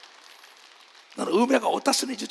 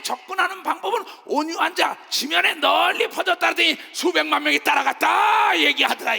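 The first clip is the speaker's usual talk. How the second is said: loud, rousing sermon delivery; fiery pulpit preaching; full-force preaching; 6 semitones higher, 4 dB louder than usual. Acted. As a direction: preach it out to the congregation.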